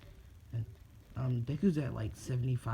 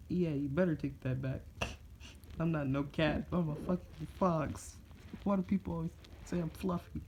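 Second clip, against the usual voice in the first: raised, put-on grumbling fox voice; offended fox; 6 semitones higher than usual. Fox Kurama protests being called fluffy, offended. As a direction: complain in a higher, indignant character voice.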